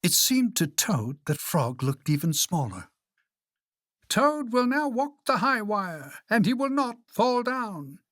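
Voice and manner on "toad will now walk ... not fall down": high, grand announcer; high-pitched showman's introduction; strange announcer voice. A narrator's reading. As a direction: announce a circus act in a high voice. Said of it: "said the voice".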